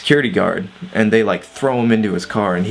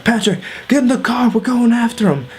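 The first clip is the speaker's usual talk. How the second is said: put-on urgent drunk friend; 13 semitones higher than usual. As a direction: ham up a frantic drunk buddy. Mario urgently ordering, voiced.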